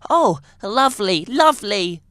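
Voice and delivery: delighted. Acted. Child rabbit delighted.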